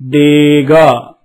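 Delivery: teacher drawing out డేగా slowly; slow model reading. Shown as a demonstration. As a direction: stretch each syllable for the class to copy. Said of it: "మళ్ళీ చెప్పండి".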